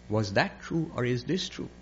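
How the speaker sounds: soft, absent-minded question; quietly puzzled emperor; dazed questioning. Acted. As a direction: murmur it as if lost in thought. Emperor answers only with his question.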